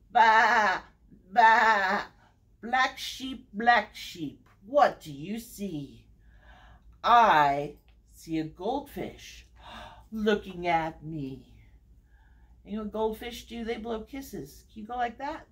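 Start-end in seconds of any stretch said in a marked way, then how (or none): 0.1-2.1 loud sheep bleat; emphatic imitation baas. Shown as a demonstration. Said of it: "your best sheep impression". 2.6-11.5 sing-song read-aloud, each repeated name stepping down; story time refrain with falling line ends; picture-book reading. Acted. Reads picture book to children.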